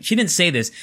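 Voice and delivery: ordinary talk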